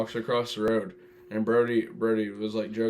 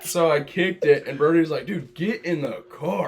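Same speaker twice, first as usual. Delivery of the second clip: laughing; 3 semitones higher, 7 dB louder than usual. Real, not acted. Laughing while telling skunk kick.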